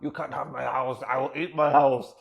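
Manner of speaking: hammed-up desperate woman, building to loud; mock deranged defiance. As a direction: defy them like someone who has lost it. Woman who has lost it, eating rubble.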